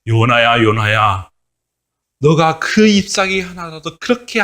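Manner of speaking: God gently rebuking Jonah, slow and solemn. Acted. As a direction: speak as God, weighty and slow.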